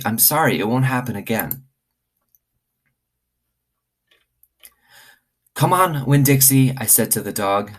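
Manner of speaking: lively read-aloud for the class; story reading to children. Acted. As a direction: tell it like a bedtime story to kids. Reads chapter one aloud to class.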